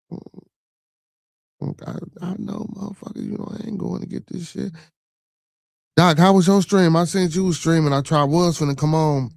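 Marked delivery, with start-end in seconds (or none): 0.1-4.7 unvoiced, hushed aside; whispered aside; muttering aside. Real, not acted. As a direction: breathe it under your breath.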